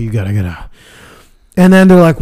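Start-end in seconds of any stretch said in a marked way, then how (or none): 0.0-0.7 low, soft and tense; hushed order. Retold or imitated. To his sister doing homework, low and hushed. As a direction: keep it down but firm.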